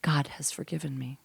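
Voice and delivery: put-on whispered, reverent German-accented quote; attempted German accent. Mother-in-law on late pregnancy, whispered German accent. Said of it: "in her German accent, which I can't do".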